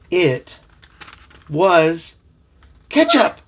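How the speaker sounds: building up to a high, loud "ketchup!"; rising suspense that bursts on the last word; dramatic reveal. A narrator's reading. Dad reading to his kids, building to a reveal.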